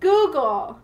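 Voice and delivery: high, loud "Google" with a falling glide; exaggerated Google. Shown as a demonstration. Joking about the weird word after her accent, exaggerated.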